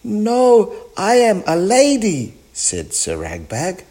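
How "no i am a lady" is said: high, loud put-on lady voice; pretend lady. A narrator's reading. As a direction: protest in a high-pitched lady's voice.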